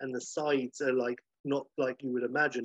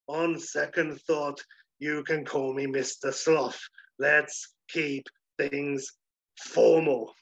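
slow pompous sloth; slower than usual. Acted.

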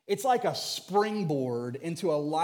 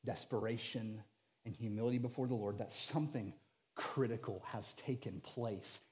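softer, intimate preaching voice; hushed sermon; 7 semitones lower, 8 dB quieter than usual. Acted. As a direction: draw the congregation in close, softly. Hushed climax of appeal.

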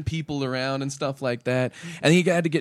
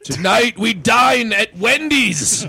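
slow booming shout, much higher and louder; parody war cry; 11 semitones higher, 10 dB louder, much slower than usual. Acted. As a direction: bellow it like a movie king rallying troops.

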